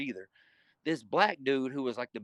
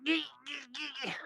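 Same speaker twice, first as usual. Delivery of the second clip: high strained squeals; 11 semitones higher, 5 dB quieter, faster than usual. Retold or imitated. Fighter showing how they strained while pinned, high strained squeals. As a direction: squeal out short strained noises. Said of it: "So I'm like this".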